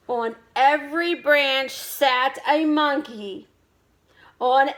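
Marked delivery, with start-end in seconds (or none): none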